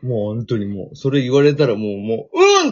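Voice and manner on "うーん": high, loud swooning squeal; mock ecstatic squeal. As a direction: squeal like you've been won over. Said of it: "ってなる。". (うっとりした甲高い叫び)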